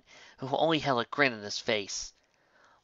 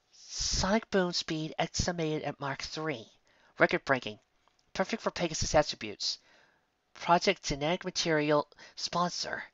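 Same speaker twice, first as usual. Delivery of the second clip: read-out notes; 5 semitones higher, 3 dB quieter than usual. A narrator's reading. Reading notes in a voice.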